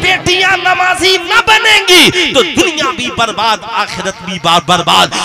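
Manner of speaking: high, loud sermon cries to the crowd; fiery preaching. Acted. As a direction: rouse the congregation, shouting. Preaching at full force.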